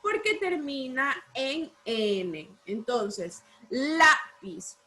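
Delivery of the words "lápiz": "lápiz" split in two, strong LÁ then falling piz; stress on LÁ. Shown as a demonstration. Teacher shows class the strongest syllable, "lápiz" stressed on LÁ. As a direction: hit LÁ in "lápiz", pause, drop piz. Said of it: "¿Dónde lleva el mayor fuerza de voz?".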